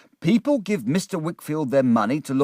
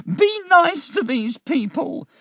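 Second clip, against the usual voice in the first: put-on much higher, pleading old mother's voice; 5 dB louder than usual. A narrator's reading. Missus Heap pleading with her son, high and begging. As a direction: beg your son to behave, high-pitched. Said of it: "Missus Heap said".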